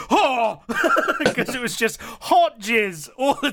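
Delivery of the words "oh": high, loud "oh"; mock shocked cry; imitated valet's exclamation. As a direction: react loudly as the valet. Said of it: "the valet was like".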